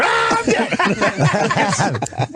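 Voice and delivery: high mock scream of doom; panicked death scream. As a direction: scream it like your last second, hammed up. Mimics doomed crew's last scream.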